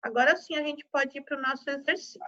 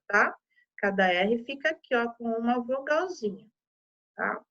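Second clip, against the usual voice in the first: ordinary talk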